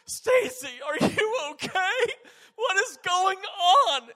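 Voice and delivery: high, loud, wailing panic; hammed-up tearful shriek of alarm; terrified mom. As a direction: cry out like a horrified mother, drawing out the last word. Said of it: "tears of terror".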